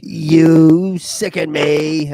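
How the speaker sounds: very slow, loud mock disgust; hammed-up, lingering revulsion; drawn-out disgust. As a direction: stretch every word of "You sicken me". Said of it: "I like how he says it".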